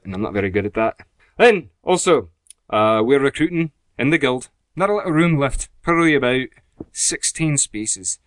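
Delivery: put-on Scottish announcement; Scottish accent. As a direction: read the notices as a Scot. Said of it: "Let's try this again".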